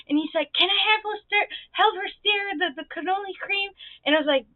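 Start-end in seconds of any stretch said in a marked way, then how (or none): 0.5-3.7 put-on high, slowing child-like request; mock kid voice, pitched up; pleading little kid. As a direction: imitate a small boy angling to help. Voicing small boy pleading to help.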